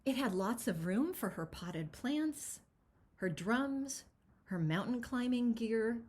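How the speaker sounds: lively picture-book read-aloud; expressive storybook narration; reading to children. Acted. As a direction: read it as if to a room of kids.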